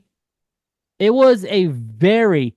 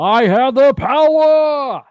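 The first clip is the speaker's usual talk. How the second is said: much higher, slow heroic cry; He-Man; 8 semitones higher, slower than usual. Retold or imitated. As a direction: raise it up like a triumphant hero. Echoing He-Man catchphrase.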